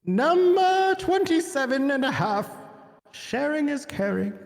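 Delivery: high, slow announcer voice; mock announcer. Cohost announces the next tip, high and slow. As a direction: boom out the next tip like a game-show host. Announcing a list item in character.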